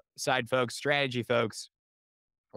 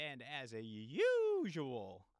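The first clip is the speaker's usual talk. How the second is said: USUAL stretched long and loud; showy host catchphrase with a long, loud USUAL; drawn-out catchphrase; 4 dB louder, much slower than usual. Real, not acted. Podcast intro catchphrase, drawn out.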